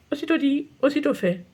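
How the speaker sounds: plain reading voice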